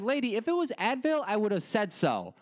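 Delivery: high, mock-exasperated inner rant. He vents at the pharmacist in his head, high and exasperated. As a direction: vent at her in a high put-upon voice. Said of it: "And I was like".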